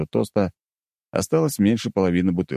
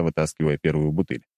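ordinary talk

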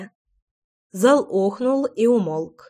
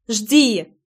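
short, moderately raised command; barked order. A narrator's reading. Loy ordering Tairin to wait, mildly barking. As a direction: bark it back, somewhat restrained. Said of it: "гаркнула в ответ Лой".

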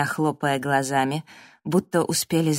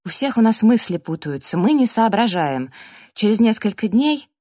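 raised, strengthened voice; louder and higher, taking charge; firmer, more confident; 5 semitones higher, 5 dB louder than usual. A narrator's reading. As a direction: speak up with growing assurance. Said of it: "голос её окреп, зазвучал увереннее".

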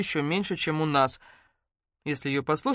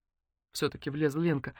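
ordinary delivery

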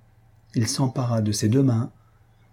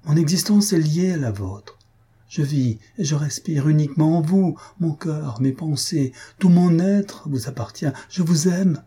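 higher-pitched, ardent avowal; impassioned declaration; 6 semitones higher than usual. A narrator's reading. Mario declares his love to Faustine, impassioned. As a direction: pour out the love, building to the end.

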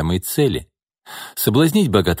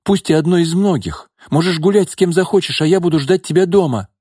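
much higher, louder, desperate woman's voice; 10 semitones higher, 4 dB louder than usual. A narrator's reading. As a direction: offer anything to keep him. Nina offers herself.

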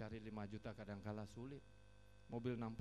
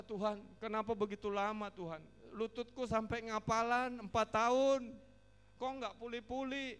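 retold desperate plea; 9 semitones higher, 9 dB louder than usual. Retells his desperate prayer when bankrupt.

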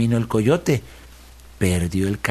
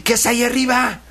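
calling up; 12 semitones higher than usual. A narrator's reading. Coyote calls up to moon.

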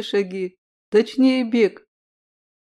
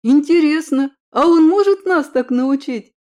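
higher, louder eager question; eager excitement; 5 semitones higher, 6 dB louder than usual. A narrator's reading.

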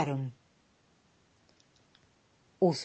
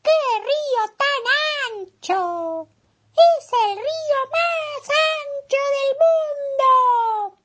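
high, tiny flea lamenting; 4 dB louder than usual. Acted. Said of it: "se lamentó la pulga".

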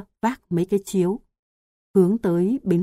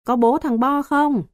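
raised, higher call across the water; calling out from the bank; 7 semitones higher, 4 dB louder than usual. A narrator's reading. Neighbor calling from the riverbank for Bo's father, loud call. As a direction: call out to someone far off. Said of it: "Có tiếng gọi trên bờ".

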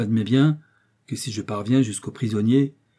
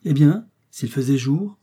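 slow, louder, higher leading question; singular, pointed tone; 5 semitones higher, 6 dB louder than usual. A narrator's reading. Doctor questions Joe's wish, in a curious tone. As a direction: echo it back intriguingly, ending on a rise. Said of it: "d'un ton singulier".

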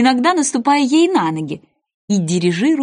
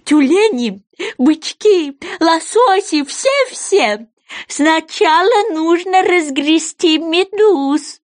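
high, loud creature voice, drawn out; eager goby; 8 semitones higher, 4 dB louder, much slower than usual. Acted. Goby calls the sea animals to line up, eagerly. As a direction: call out the list high and insistent.

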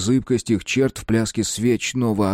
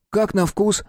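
eager question; 11 semitones higher than usual. A narrator's reading. Mark eagerly asks.

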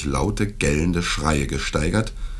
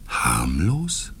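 astonished. A narrator's reading. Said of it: "fragte er mit unverhohlenem Staunen".

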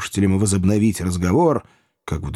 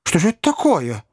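higher, puzzled gentleman's question; Pickwick, alarmed; 8 semitones higher than usual. A narrator's reading. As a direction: ask in alarm, voice lifted higher. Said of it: "мистер Пиквик". (встревоженный вопрос)

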